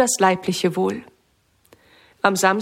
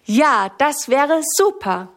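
drawn-out, higher cry of delight; 5 semitones higher, slower than usual. A narrator's reading. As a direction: stretch it out, thrilled. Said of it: "freut sich Sammy".

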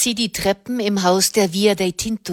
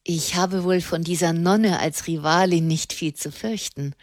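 plain reading voice

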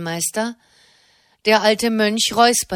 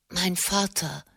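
hoarse voice; 8 dB quieter than usual. A narrator's reading. Old brother rising before the chapter, hoarse and soft. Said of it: "mit heiserer Stimme".

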